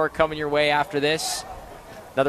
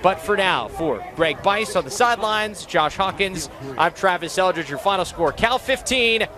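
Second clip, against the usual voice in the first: raised, energetic final-score call; higher-pitched broadcast announcer wrap-up; sports announcer sign-off; 5 semitones higher than usual. Real, not acted.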